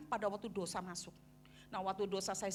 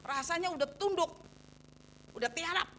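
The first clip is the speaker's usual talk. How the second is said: louder, higher mock protest; indignant protest; 6 semitones higher, 4 dB louder than usual. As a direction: push back, retelling an indignant objection. Acted protest to God. (protes kesal yang ditirukan)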